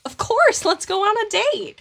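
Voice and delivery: high, excited acceptance; eager yes. Retold or imitated. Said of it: "you can respond to the Grim Reaper with".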